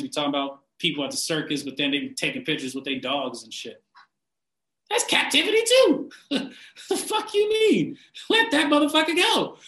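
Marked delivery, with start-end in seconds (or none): 4.9-9.6 high laughing outburst. Real, not acted. Mocking vegans with dogs.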